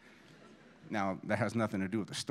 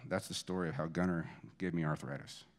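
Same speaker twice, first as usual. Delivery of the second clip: ordinary delivery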